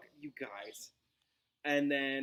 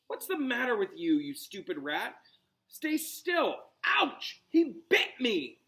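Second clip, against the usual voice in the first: higher, louder cry of pain; annoyed yelp; 8 semitones higher, 5 dB louder than usual. Acted. Ron yelps at the rat that bit him, higher and louder. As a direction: snap at the rat, then yelp.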